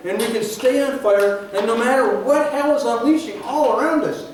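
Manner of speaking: loud, high, emphatic preacher delivery; impassioned preaching. Real, not acted. Preacher tells the congregation their passion stays on fire, impassioned. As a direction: preach it with force, drawing out key words.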